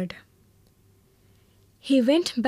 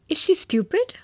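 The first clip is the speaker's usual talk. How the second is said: incredulous question; 8 semitones higher, faster than usual. A narrator's reading. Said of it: "asked Behrman".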